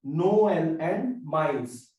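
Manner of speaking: No-el and ma-is, slowly split into syllables; emphasis on second vowel. Shown as a demonstration. Teacher stressing the trema letter, Noel and mice drawn out slowly. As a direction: stretch Noel and mice so both vowels sound. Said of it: "emphasize on this letter".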